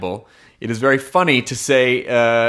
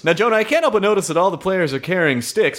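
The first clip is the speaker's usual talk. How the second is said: high, bright play-by-play call; sports announcer; 4 semitones higher than usual. Acted. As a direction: announce it like live TV. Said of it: "start announcing".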